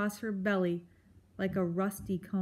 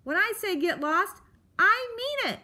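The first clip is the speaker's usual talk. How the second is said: high, louder final roar; bellowing porcupine; 10 semitones higher, 5 dB louder than usual. A narrator's reading. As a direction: bellow at the fox. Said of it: "Aerith bellowed".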